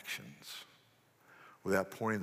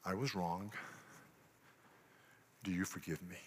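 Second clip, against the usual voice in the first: quiet confession; 2 semitones lower, 7 dB quieter than usual. Shown as a demonstration. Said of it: "a little sentence".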